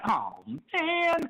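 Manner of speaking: high, let-down "oh man"; dismayed groan. Retold or imitated. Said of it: "I'm like".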